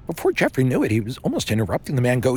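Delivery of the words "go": ordinary delivery, nothing marked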